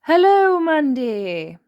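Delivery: high, loud hearty hello; old rabbit welcoming guests; Grampy Rabbit greeting. Acted.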